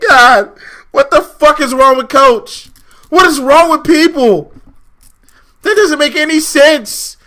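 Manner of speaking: real fed-up outburst; exasperated rant. Real, not acted. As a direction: complain in total disbelief. Exasperated rant about Coach.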